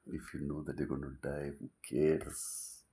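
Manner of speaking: normal talking voice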